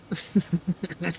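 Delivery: a quick scoffing laugh; brief disbelieving chuckle; short laugh. Real, not acted. Laugh after outburst.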